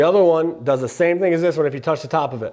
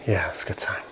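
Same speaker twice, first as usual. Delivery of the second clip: hushed whisper; breathy whisper in the dark; 9 semitones lower, 10 dB quieter than usual. Real, not acted. Whispering during session.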